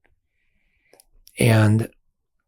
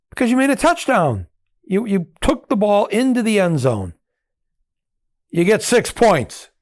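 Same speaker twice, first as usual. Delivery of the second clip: emphatic insisting; 10 semitones higher, 3 dB louder than usual. Retold or imitated. Insisting to British guy.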